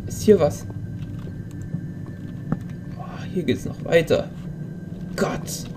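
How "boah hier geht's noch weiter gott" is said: awed nervous whisper. Real, not acted. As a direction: breathe it out under your breath. Nervous in a dark cave.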